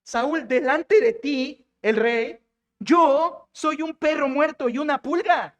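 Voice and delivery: high, slow, drawn-out declaiming; dramatic preacher acting a humble plea; impassioned self-abasement. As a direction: proclaim your lowliness before a king, stretching the words. Acting David humbling himself before Saul.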